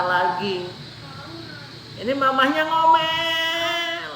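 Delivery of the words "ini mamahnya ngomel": high, drawn-out mock nagging; mamah ngomel. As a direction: stretch it high like a scolding mother. Mimics nagging mother, then child follows. (menirukan ibu ngomel, nada tinggi dipanjangkan)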